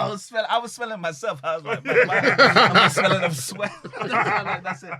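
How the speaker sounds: laughing through the words, high and loud; can barely get it out for laughing. Real, not acted. Laughing while retelling embarrassment.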